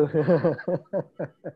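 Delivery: a real, happy laugh at the memory; delighted laugh. Real, not acted. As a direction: laugh warmly, remembering the food. Reminded of favourite Salatiga food. (tawa senang yang tulus)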